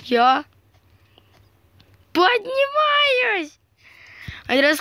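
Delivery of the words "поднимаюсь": high, slow stretched squeal; high drawn-out cry. Pretend. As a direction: draw the word out high. Repeats flying up cry.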